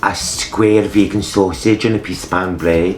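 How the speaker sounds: put-on Scottish accent, savouring the food; mock Scottish accent for a listener. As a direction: list it in a broad Scottish accent. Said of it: "we'd do a Scottish this week".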